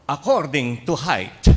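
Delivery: loud, high, drawn-out command; announced order. Retold or imitated. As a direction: call it out slowly to a whole line. Monsignor orders the line by height. (malakas at mabagal na utos sa pila)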